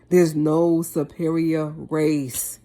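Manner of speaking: the words slowed right down, each one weighted; to the viewers, slowly hammering home There's no superior race; slow, deliberate. Real, not acted. Vlogger pleading with viewers, slow and deliberate.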